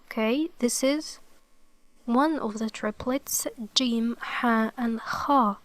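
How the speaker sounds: ordinary delivery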